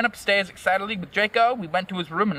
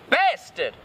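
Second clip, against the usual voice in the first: angry shout; 7 semitones higher than usual. A narrator's reading. Narrator snaps at whoever bumped into her, shouting angrily. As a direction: cry out in mock anger. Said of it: "I shouted angrily".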